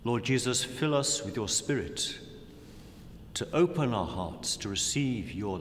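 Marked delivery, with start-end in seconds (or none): none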